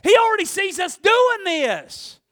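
high, loud drawn-out preacher shout; full-force preaching. Real, not acted. Preacher firing up the congregation, full-force shout. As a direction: belt it out to the back pew.

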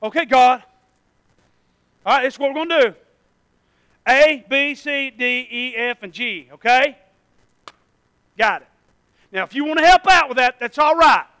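loud, brisk orders to God; bossy planner. Pretend. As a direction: lay out the plan like a boss. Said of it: "'Cause I'm a planner".

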